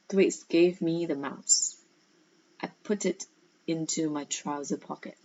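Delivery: soft, hushed read-aloud; story reading. A narrator's reading. Teacher reading the sweet shop scene to pupils, hushed. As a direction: draw the class in quietly.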